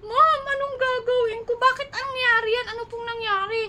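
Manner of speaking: high, tearful pleading, voice breaking into a cry; near tears. Retold or imitated. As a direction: beg for help on the edge of crying. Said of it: "Mangiyak-iyak na ako".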